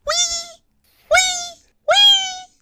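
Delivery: very high thin squeal; imitated spider screech, extremely high-pitched; spider scream. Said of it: "they sort of go, like a spider screaming".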